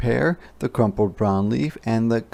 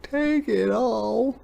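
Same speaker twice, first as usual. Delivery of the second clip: higher, slow little voice; higher slow hedgehog; hedgehog offering; 12 semitones higher, slower than usual. A narrator's reading.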